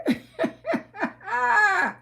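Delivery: sudden high cackling laugh; big laugh. Real, not acted. Cackling to the viewers at her own roast of Peach.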